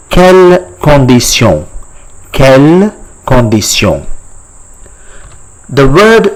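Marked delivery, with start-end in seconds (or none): 0.1-4.1 "quelle condition" as kel kon-dee-syon; French pronunciation. Shown as a demonstration. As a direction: teach "quelle condition" with a nasal French ending. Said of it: "in French is called".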